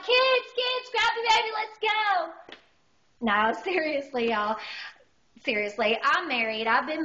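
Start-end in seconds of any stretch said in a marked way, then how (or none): 0.0-2.6 high-pitched panicked holler; rally the kids in mock panic, voice way up; acted alarm, urgent high call; freaked out. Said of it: "totally freaked out".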